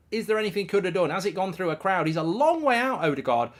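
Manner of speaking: high, loud live commentary; urgent, animated match analysis; excited goal recap. Real, not acted. Recapping the goal just scored.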